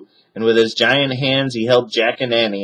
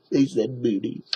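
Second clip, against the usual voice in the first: amused aside; 3 semitones higher, 7 dB quieter than usual. Real, not acted.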